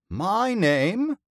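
high, loud theatrical proclamation; eloquent bellow. A narrator's reading. Old actor introduces himself to boy, bellowing eloquently. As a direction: bellow it like a grand old thespian. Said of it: "the old one bellowed eloquently".

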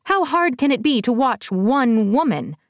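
a seething villainess scolding her minion; seething, exasperated. A narrator's reading. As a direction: voice pushed up high in contempt. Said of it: "Sybil seethed".

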